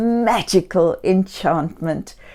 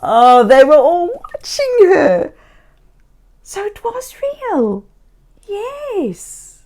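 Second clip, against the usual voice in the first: delighted wonder; 9 semitones higher, 3 dB louder than usual. Acted. Delighted realization.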